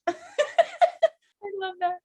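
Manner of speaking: high-pitched giggly delight; laughing. Real, not acted. Speaker enjoys the joke in the play, laughing. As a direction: laugh through the words. Laughing at her own acting.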